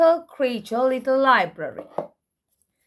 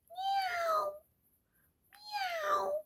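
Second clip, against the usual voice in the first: high, soft meowing; cat meow; 14 dB quieter than usual. Shown as a demonstration. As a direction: mew like a little cat. Reading animal sounds book.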